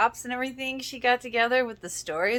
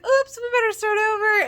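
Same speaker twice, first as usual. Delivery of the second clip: high, louder mock innocent oops; playful Gigi; 13 semitones higher, 8 dB louder than usual. Gigi pretending the kiss was an accident, playful mock innocence. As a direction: sing it out like a fake accident. Gigi quoted after a fake mistake.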